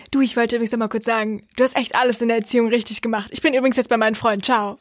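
fast, throwaway rattle ending in a laugh; mock breezy compliment. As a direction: rush through it like a tossed-off goodbye. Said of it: "der hingeht und sagt".

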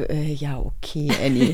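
unimpressed. Retold or imitated. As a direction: sound politely unimpressed. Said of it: "und alle so".

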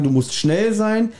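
normal talking voice

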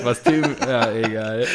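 ordinary delivery

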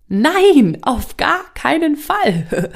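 voice of the subconscious. Acted. Subconscious refusing the listener's wish, loud and slow. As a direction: play the stubborn inner voice slamming the door. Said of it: "dein Unterbewusstsein sagt".

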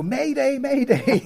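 put-on high, playful child calling Mayday; little girl's voice. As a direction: play a seven-year-old trying out the radio. Girl playing at radio distress.

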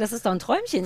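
ordinary talk, nothing marked

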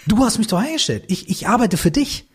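höher gesprochener Beamter, sachlich verteidigend; innerer Beamter. Retold or imitated. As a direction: den eingestellten Angestellten spielen, deutlich höher. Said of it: "Und der Typ so". (Sprecher spielt seinen inneren Angestellten, höher)

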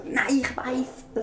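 high, slow indignant aunt voice; a wounded relative insisting; offended aunt. Pretend. Aunt answering quiz question.

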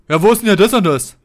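imitating a customer who blurts out a loud demand; mock rude demand. Customer accosts shop staff without saying hello, mock rude demand. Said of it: "so vollpöbeln gleich".

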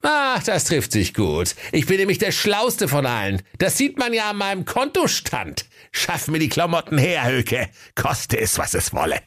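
high, self-satisfied rich man; Kaiser calling out. Acted. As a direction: boast grandly, slowing and dropping at the end. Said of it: "Da rief der Herr Kaiser".

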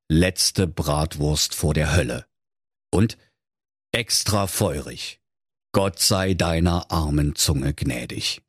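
slow, low and grave; ominous reading. A narrator's reading. Narrator reading the sign, slow and ominous. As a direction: read the sign like a warning.

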